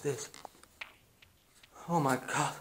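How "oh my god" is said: ordinary talk, nothing marked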